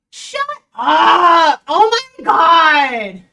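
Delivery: loud, high outburst of disbelief; shocked shout. Real, not acted. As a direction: yell it out in real shock. Shocked by the article's advice.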